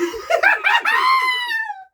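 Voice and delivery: very high squealing laugh; laughing fit; helpless laughter. Real, not acted. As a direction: burst into loud, high-pitched laughter. Helpless laughter at own story.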